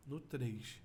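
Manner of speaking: ordinary talk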